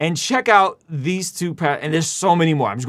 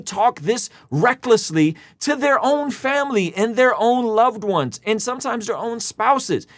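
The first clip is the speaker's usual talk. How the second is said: higher, lifted voice for Preach's point; paraphrasing Preach; 7 semitones higher than usual. Retold or imitated. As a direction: echo Preach's argument with energy. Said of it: "Preach is saying".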